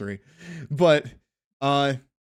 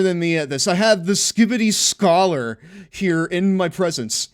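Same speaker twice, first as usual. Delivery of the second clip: emphatic host voice, lightly done; show host intro; 5 semitones higher, 5 dB louder than usual. Acted. Hosts a mock show intro.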